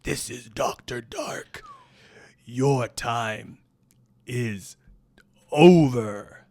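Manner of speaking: slow, menacing villain promo with the last word blasted; wrestling heel menace. Acted. Interviewer asks for the heel, menacing slow villain voice. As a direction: intone it like a ring villain, then explode on the end. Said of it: "may I please speak to Doctor Dark?".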